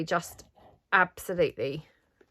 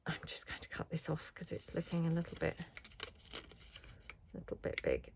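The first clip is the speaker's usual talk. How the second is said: whisper; 16 dB quieter than usual. Real, not acted. Muttering to herself while cutting.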